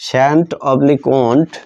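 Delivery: usual speaking voice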